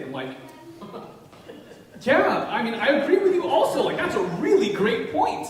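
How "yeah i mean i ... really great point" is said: higher and louder, laughing through it; laughing agreement. Retold or imitated. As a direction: cheerfully agree while laughing. His amused agreement again.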